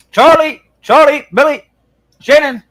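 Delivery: high, loud calling out. Real, not acted. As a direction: call the names out loud. Calling out to a viewer.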